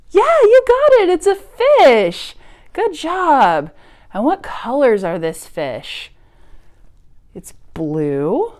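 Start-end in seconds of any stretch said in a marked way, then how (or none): 0.1-3.7 much higher and louder, delighted; excited praise. Real, not acted. Teacher cheering children who named the fish, excited.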